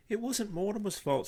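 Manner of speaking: put-on high, pleading small girl; little girl. A narrator's reading. Arabella defending Mortimer to her father, high. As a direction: defend the bird earnestly.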